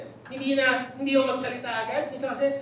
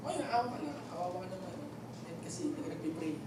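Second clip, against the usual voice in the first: soft, lower, mock-pitying bystander; 5 semitones lower, 10 dB quieter, faster than usual. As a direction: coo fake sympathy, quietly and a bit fast at the end. Acts out a pitying onlooker. (mahinang kunwaring naaawa)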